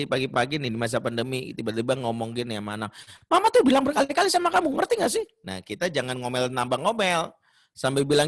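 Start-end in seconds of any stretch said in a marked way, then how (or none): 3.3-5.2 high, loud, fast nagging mom; exasperated mother nagging her child; shrill scolding mother. Acted. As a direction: snap at the kid like a frazzled mother.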